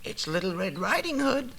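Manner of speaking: the wolf pretends to be a little girl; wee little voice. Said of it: "in a wee little voice".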